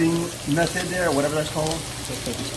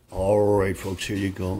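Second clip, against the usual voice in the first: ordinary talk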